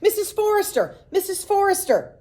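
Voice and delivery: high, loud taunting shout; shouting at top of lungs. A narrator's reading. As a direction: holler for the mother to come. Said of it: "shouted at the top of their lungs".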